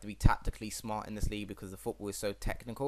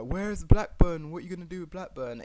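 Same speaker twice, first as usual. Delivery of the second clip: imitating commenters; 8 semitones higher, 9 dB louder than usual. YouTuber mimicking viewers who want Blackburn back, demanding. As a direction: play a pushy fan asking. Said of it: "some of you may comment and be like".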